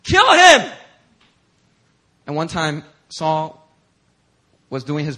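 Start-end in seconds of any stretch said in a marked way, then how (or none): 0.0-0.7 higher and louder, rising to a yell; urging shout. Retold or imitated. David's men urging him to kill Saul, rising shout. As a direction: egg him on to strike. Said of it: "they were like".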